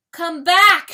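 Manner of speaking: loud, high, desperate wail; wailing cry. Acted. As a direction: call after him as he vanishes. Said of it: "she wails".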